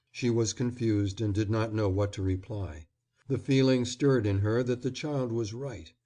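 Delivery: ordinary delivery, nothing marked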